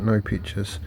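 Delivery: ordinary talk, nothing marked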